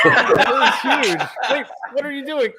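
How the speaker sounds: laughing through the words; giggling while talking. Real, not acted. Laughing at tickling on screen.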